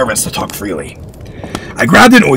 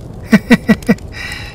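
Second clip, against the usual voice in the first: high unvoiced chuckle; hearty laugh; 12 semitones higher than usual. Lord laughing at the boy, high chuckle. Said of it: "He laughed".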